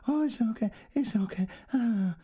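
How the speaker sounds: high cooing to a small dog. A narrator's reading. Woman coos to her rescued dog, high and tender. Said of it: "she cooed to it".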